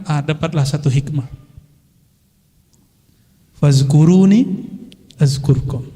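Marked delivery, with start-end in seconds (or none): 3.5-5.9 slow recitation. Acted. Preacher quoting Quranic verse.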